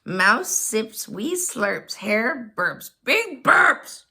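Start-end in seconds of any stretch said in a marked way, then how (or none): 3.0-4.0 big loud emphasis. Acted. Burps in story.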